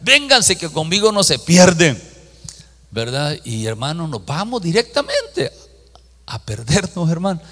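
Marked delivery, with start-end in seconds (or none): 0.0-2.0 calling out. Retold or imitated. Calling old ladies to follow.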